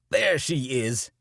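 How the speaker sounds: loud, slow ranting cry; shouting preacher. A narrator's reading. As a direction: bellow it like a wild-eyed preacher. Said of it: "Carey shouts".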